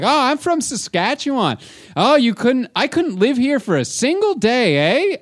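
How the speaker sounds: high-pitched caricature of a Saskatchewan woman; Barb. Retold or imitated. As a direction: boast obliviously in a high mock voice. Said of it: "She's like".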